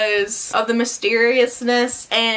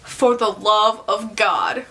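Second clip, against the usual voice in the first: slow, measured echo of the plea; slow, deadpan story quote; unmoved echo; slower than usual. Acted. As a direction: repeat the plea slowly and deliberately, unmoved. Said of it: "And he's like".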